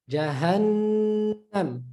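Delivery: jahannam with a long nasal nn; prolonged noon. Shown as a demonstration. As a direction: hum on the nn of jahannam before finishing. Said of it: "Prolong that nasal sound".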